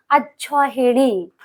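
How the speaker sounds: अक्षौहिणी slowly, every syllable clear; slow careful pronunciation. Shown as a demonstration. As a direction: read out अक्षौहिणी for dictation. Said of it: "ना होके क्या होगी". (अक्षौहिणी धीमे स्वर में साफ़)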